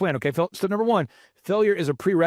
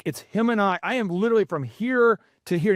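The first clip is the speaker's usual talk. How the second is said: usual speaking voice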